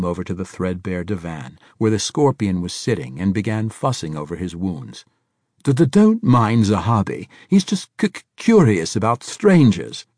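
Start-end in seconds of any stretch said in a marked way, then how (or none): 5.6-10.1 high, loud stuttering fusspot; stammering old man. A narrator's reading. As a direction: stammer through the reassurance.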